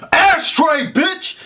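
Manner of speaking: sudden high mock holler; imitated yelling. Reviewer imitating an actor switching to eleven, sudden mock yell. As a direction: burst out yelling, pitch shooting up. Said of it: "he's just yelling".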